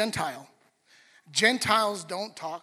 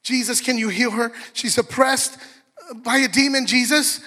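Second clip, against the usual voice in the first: louder, higher, urgent begging; desperate plea; 4 semitones higher, 6 dB louder than usual. Retold or imitated. A mother crying out to Jesus for her daughter, loud urgent plea. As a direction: plead loudly for your child. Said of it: "And she's crying".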